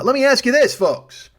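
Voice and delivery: loud, high ask the crowd; showman aside. Real, not acted. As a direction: pitch it up to the audience. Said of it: "rhetorical aside to audience".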